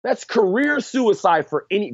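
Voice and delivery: high, drawn-out incredulous emphasis; real worked-up fan rant; emphatic disbelief. Real, not acted. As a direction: stretch the key word in amazed protest. Incredulous rant about a risky release.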